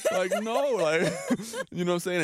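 high-pitched, loud, laughing through the words; laughing mock bravado. Joking to Paris that he'd hide his tears, laughing hard. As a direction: play it up, cracking up at his own tough-guy act.

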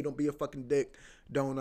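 ordinary talk

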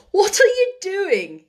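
lightly voiced astonished boy; boy startled by her Yorkshire; amused surprise. A narrator's reading. Colin amazed by her talk.

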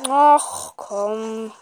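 loud annoyed groan; annoyed outburst. Real, not acted. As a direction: vent the disappointment loudly. Annoyed at bad loot.

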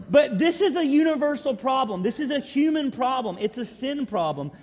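loud, high preacher's build; full-force preaching. Acted. Preacher to congregation, naming sin, full-force preaching. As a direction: drive the point home from the pulpit.

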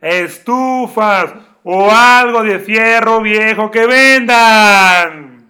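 loud, slow junk-buyer loudspeaker call; crackly looped vendor recording. Retold or imitated. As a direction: drag it out like a street truck speaker. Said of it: "Me falló ahí la garganta". (grabación de fierro viejo a todo volumen)

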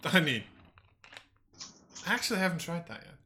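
ordinary talk, nothing marked

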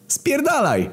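high, cartoonish, curt reply; high snarl. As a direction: bark it back in one word. Footballer's one-word reply.

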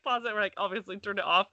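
ordinary talk

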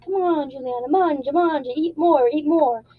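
hammed-up Italian family insisting she eat; imitating pushy Italian relatives. Big Italian family urging her to eat, mock coaxing. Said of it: "they're gonna be like".

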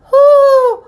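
high, long, loud hoot; loud seal call. A narrator's reading. Mother seal calling across the sea, hooting. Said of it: "they heard a loud".

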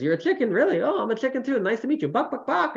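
high, playful mock delight; farmer playing chicken. Farmer greeting the chicken prince, high and playful. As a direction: ham up the fellow chicken. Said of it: "He says".

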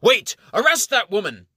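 high, loud alarm shout; guard calling out. Acted. Guard alerting others, loud shout. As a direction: shout the order to arrest. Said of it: "A guard calls out".

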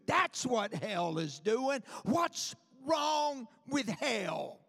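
ordinary delivery

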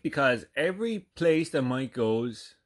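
usual speaking voice

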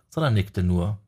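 plain reading voice

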